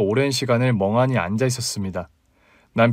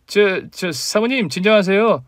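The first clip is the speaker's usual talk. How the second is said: high, slow, stammered plea; flustered pleading; 8 semitones higher, slower than usual. A narrator's reading. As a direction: try to calm her, hesitating. Colleague nervously tries to calm wife.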